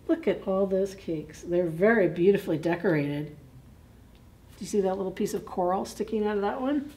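plain reading voice

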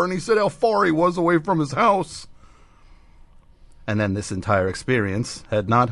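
ordinary talk

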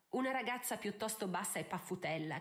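ordinary delivery

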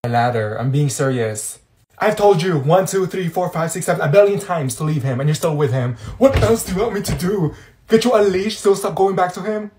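usual speaking voice

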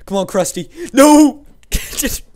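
urgent plea rising to a high yell; loud cry, building to a big "No!"; excited shouting. Real, not acted. Gamer urging on his fighter, shouted.

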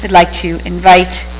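usual speaking voice

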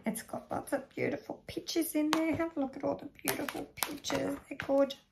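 put-on kids party magician. Magician shows book to children.

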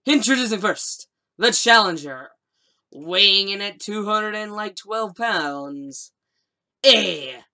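booming, drawn-out ring announcer intro. Pretend. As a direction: stretch every name out, big and loud. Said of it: "in my announcer voice".

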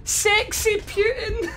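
laughing at being killed by a player named Sexy Putin. Real, not acted. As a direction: barely get it out for laughing.

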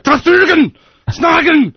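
high shouted gibberish German; fake German. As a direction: yell made-up German words. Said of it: "gibberish German".

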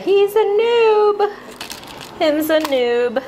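high, slow mocking tease; teasing. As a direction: tease like talking to a toddler. Teasing husband's noob sign.

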